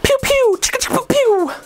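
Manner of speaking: squeaky toy-gun noises; silly high laser noises. Acted. Joking mid-rant, making high laser noises. Silly sound effect interlude.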